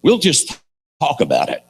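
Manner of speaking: mocking high start that drops away; sarcastic. Preacher mocks leaders avoiding the fight, sarcastic. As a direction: parody leaders who only talk. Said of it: "make sure you heard the sarcasm in that".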